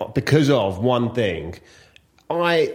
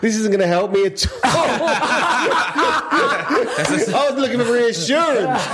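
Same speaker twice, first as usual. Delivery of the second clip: high-pitched laughing dismay; comic dismay; 9 semitones higher, slower than usual. Real, not acted. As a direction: pitch shoots up in appalled disbelief. Nervous flyer hears of crash landing.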